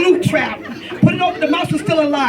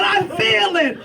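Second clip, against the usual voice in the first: higher-pitched mock yell; stylized, high scream for mom; retold child's scream; 5 semitones higher, slower than usual. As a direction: imitate a boy crying out, without real volume. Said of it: "He's screaming".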